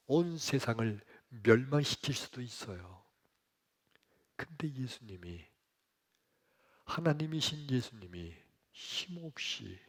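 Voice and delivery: ordinary talk, nothing marked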